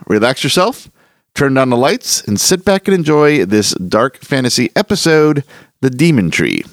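normal talking voice

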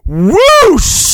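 high, loud whoosh sound; whooshing net effect. Acted. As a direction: swoop the sound up like a net snapping shut.

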